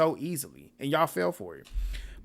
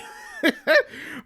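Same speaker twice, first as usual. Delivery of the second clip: shrieking laugh, much higher; high burst of laughter; 3 dB louder than usual. Real, not acted. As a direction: cackle in disbelief. Reacting to the challenge quote.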